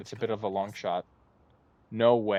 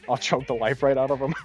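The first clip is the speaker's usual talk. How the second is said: mock pissed off; 3 semitones higher, 6 dB louder than usual. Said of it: "[name]'s pissed".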